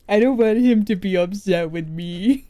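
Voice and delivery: laughing through the words. Acted. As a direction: giggle while fretting. Worried about partner, laughing.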